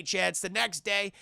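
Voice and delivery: ordinary delivery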